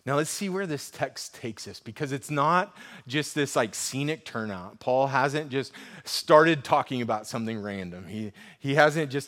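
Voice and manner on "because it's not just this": ordinary delivery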